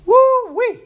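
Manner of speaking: high, loud woo-wee of mock dread; excited whoop. Real, not acted. Preacher admits humility is hard, whooping loudly.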